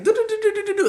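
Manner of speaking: high monotone do-do-do chatter; imitated high-pitched prattle; rapid babble. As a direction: rattle off nonsense syllables up high. Imitates own chatter with nonsense syllables.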